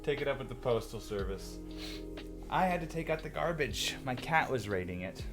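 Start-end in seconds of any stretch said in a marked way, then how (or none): none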